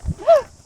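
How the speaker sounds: sharp high gasp of fright; startled gasp. Real, not acted. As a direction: gasp as if jumped at. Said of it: "you scared me".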